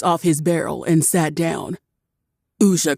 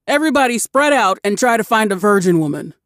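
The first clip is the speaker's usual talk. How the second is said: quick, louder order; rallying call; 4 semitones higher, 4 dB louder, faster than usual. A narrator's reading. Orc orders everyone to spread out, quick and loud. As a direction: rush the crew out.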